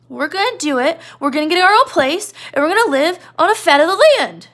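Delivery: put-on a big kid whining innocently; Lenny. Teacher voicing Lenny explaining why he came, high and childlike. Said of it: "Lenny's like".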